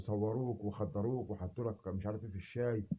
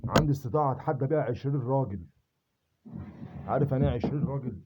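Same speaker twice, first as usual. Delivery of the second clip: louder, chest-out bragging; loud boast; 2 semitones higher, 5 dB louder than usual. Real, not acted. Boasting he can take on twenty men, loud. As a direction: boom it out like a challenge.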